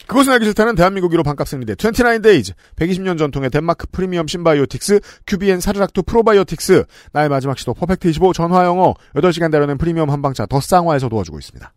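fast, bright sponsor announcement; sponsor read. Acted. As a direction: rattle off the sponsors quickly.

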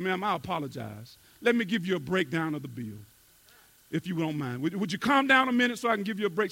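soft, low, soothing; calm plumber. Acted. Plumber calmly soothing the angry customer. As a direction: talk her down gently.

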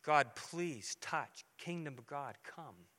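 hushed retold plea at the bedside; quiet pleading prayer. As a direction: pray alone in the room, barely audible.

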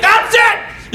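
high, loud retold shout; mock fed-up dad. Dad ending the standoff, imitated exploding shout. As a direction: imitate a father snapping at last.

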